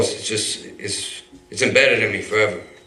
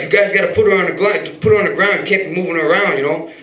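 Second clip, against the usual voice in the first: urgent yell; 7 semitones higher, 4 dB louder than usual. Retold or imitated. Yelling at men carrying injured girl.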